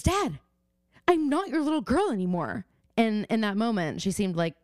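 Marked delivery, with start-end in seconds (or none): 0.0-2.7 mock-bratty teenage whine, pitched higher; bratty teen defiance. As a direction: play the sulky daughter snapping at dad, high and petulant. Arya's defiant line to her dad.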